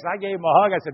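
usual speaking voice

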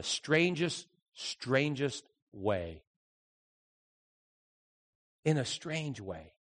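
quiet, slowing musing; slow, fading reflection. Real, not acted. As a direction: let it trail off in wonder.

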